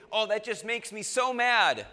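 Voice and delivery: loud, high hammed-up exasperation; exaggerated petty outrage; mock anger. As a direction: play at being furious over a trifle. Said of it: "no, you're not mad".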